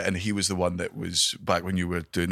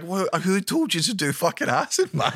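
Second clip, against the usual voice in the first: high incredulous yelp; disbelieving, pitched right up; 9 semitones higher than usual. Retold or imitated. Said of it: "I'm like".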